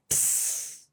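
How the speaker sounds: voiceless, very soft "ठिस"; demonstrated note. Shown as a demonstration. Singer shows pupil the missed note, softly voiceless. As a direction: let "ठिस" out as a whispered puff. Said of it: "उसके बाद एक सुर आया".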